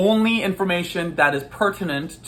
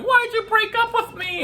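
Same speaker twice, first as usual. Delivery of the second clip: high, whiny put-on girlfriend. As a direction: plead like a dumped girlfriend. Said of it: "the girl going".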